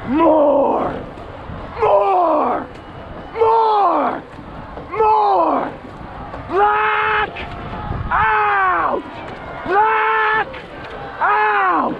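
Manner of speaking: rising shouted push. Real, not acted. [name] pushing a final sprint.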